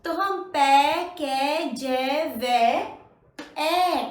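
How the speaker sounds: पै, कै, जै, वै stretched slowly and loud; drawn-out ऐ syllables. Shown as a demonstration. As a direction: hold each ऐ syllable long for practice. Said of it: "उसी तरह से इसे बोलते हैं".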